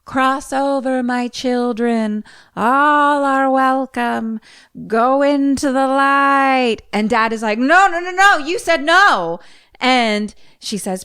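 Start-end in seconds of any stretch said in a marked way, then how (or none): 0.1-6.8 high, slow, drawn-out summons; long sustained high call; retold yelling. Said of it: "She starts yelling now". 7.6-9.4 high, loud mock panic; imitating a frantic dad; acted panicked protest. Retelling Dad's panicked protest.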